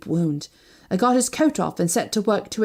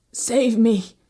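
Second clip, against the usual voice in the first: hoarse; 3 semitones higher than usual. A narrator's reading. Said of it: "he said hoarsely".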